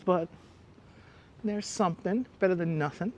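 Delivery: ordinary talk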